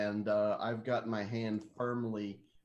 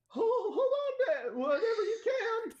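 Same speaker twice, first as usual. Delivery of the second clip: put-on very high, cracking teen-boy screech; screeching fourteen-year-old; 4 dB louder than usual. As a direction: screech a warning like a panicked teenager. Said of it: "with my booming fourteen-year-old voice, screech back".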